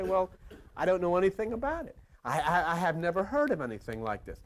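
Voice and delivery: normal talking voice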